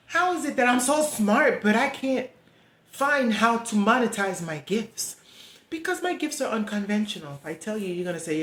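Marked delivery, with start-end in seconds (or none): 0.1-7.3 louder, pleading, voice straining with real feeling; getting emotional. Real, not acted. Asking viewers why her gifts earn nothing, emotional and louder. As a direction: let the frustration rise and spill out. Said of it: "I'm getting emotional".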